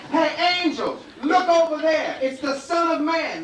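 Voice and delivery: high, loud shout to trick the angels; devil calling out. Acted. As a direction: bellow it to fool them. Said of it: "So he said".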